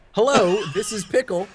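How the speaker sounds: high, bright sing-song hello; chipper phone greeting. Shown as a demonstration. Coworker at work, answering the phone, overly cheerful high greeting. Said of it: "answering the phone at work like that".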